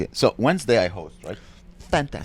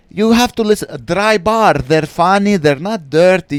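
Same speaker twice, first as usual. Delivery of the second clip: high, loud mock Arabic-accented heckler; thick Arabic accent; 9 semitones higher, 7 dB louder than usual. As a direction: insist loudly in a caricatured Arabic accent. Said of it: "with a thick Arabic accent".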